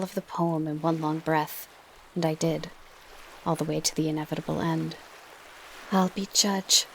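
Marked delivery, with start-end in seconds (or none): 5.9-6.9 lightly voiced sly Fury; a hint of cunning Fury; cunning old Fury. A narrator's reading.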